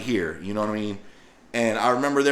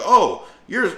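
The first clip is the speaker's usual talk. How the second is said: mocking captor; 8 semitones higher than usual. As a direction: sneer while reading the ID. Man mock-reads his ID card.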